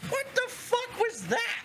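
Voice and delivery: high, outraged outburst; startled outrage. Acted. Game master as Fennel, just hit in the face, startled outrage. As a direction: burst out, shocked and indignant.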